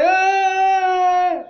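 high loud scream. A narrator's reading. John screaming while holding his father. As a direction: let out a scream. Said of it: "as he cried and screamed".

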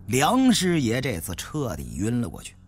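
ordinary delivery, nothing marked

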